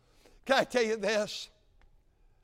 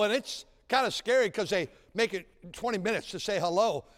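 ordinary delivery

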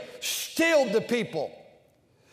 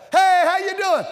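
high, loud chipper hello; cheerful greeting; 8 semitones higher, 7 dB louder than usual. Retold or imitated. Mimicking a cheerful greeter, high and bright. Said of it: "how can we talk like".

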